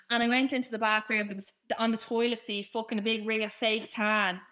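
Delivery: usual speaking voice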